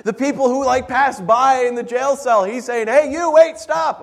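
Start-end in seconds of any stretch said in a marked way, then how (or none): 2.9-4.0 high, loud hail to a passer-by; playful shout to stop someone; acted calling out. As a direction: flag someone down urgently.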